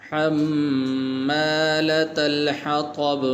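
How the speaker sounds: slow, drawn-out melodic tajweed recitation; slow tajweed chant; Quran recitation. Shown as a demonstration. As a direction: chant each word long and measured.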